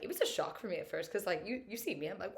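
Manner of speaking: usual speaking voice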